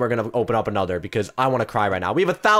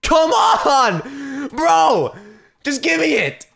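high, loud exasperated demand; high, strained outburst of frustration; frustrated yelling; 13 semitones higher, 6 dB louder, much slower than usual. Real, not acted. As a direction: yell at the game in despair. Begging the game after losing.

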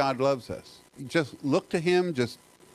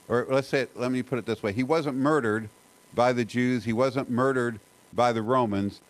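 ordinary talk

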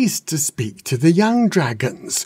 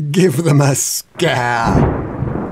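laughing; 3 dB louder, much slower than usual. A narrator's reading. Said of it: "laughed the wise old dragon".

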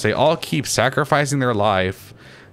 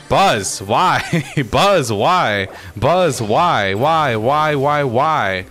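loud, higher cries of disbelief with a laugh breaking in; exasperated wail; 3 semitones higher, 3 dB louder, much slower than usual. Real, not acted. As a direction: plead in frustration, each word punched. Just killed by Buzz, wailing.

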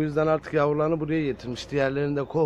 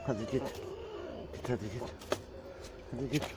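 hushed shoo to the cat; soft shooing; 11 dB quieter, faster than usual. Real, not acted. Sending the mother cat away, hushed shooing. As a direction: gently send her off, softer.

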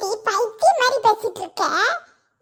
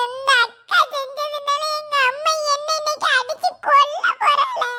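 crying. Acted. Child blaming elder sister for coming beating, crying. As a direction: sob the complaint out to a friend. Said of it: "யாமட்டி ஊதா அழுக?".

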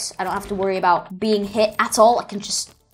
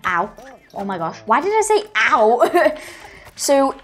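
ordinary talk, nothing marked

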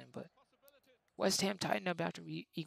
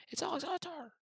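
excited call; 12 semitones higher than usual. Acted. Gamer calling his player's chance, excited commentator shout. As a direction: lift it like the ball's nearly in.

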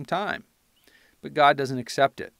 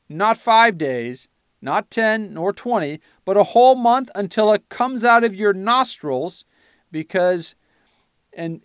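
preaching scripture; 4 semitones higher than usual. Acted. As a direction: declaim the judgment like thunder from the pulpit. Preaches God's judgment from Numbers.

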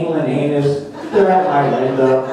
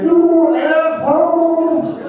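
angry fish; 13 semitones higher, much slower than usual. Retold or imitated. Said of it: "fish is giving me the middle finger".

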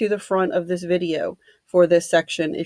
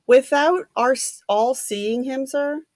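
higher-pitched, incredulous question; raised pitch, doubting what was said; unbelieving; 6 semitones higher than usual. A narrator's reading. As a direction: question it in mild disbelief, voice lifted. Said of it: "Bloor said unbelievingly".

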